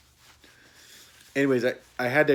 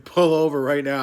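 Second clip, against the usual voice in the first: loud, higher retold command; urgent order; 4 semitones higher, 8 dB louder than usual. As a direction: mock-desperate demand to stop the car. Retells urgent order to driver.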